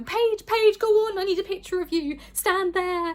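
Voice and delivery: loud, high calling out to her daughter; mum calling out. Retold or imitated. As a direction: shout her name across the street like a mum. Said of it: "my mum kept going".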